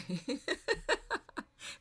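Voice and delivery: loud, high, real cackle; loud teasing laughter; big laugh. Real, not acted. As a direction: burst into high, helpless laughter. Teasing Serena about the deck.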